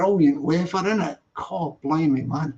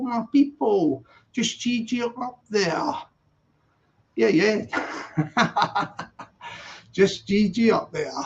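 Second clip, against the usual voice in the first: usual speaking voice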